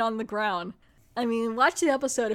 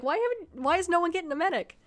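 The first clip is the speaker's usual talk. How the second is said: much higher, fast, exasperated disbelief; incredulous; 8 semitones higher than usual. Retold or imitated. Host baffled nobody fetches a medic, exasperated high disbelief. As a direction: throw up your hands at the obvious.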